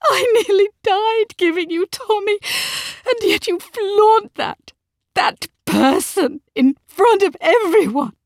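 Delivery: high, loud, anguished wail; distraught wife at full pitch; sobbing accusation. A narrator's reading. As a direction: cry it out in tearful outrage. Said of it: "she said between sobs".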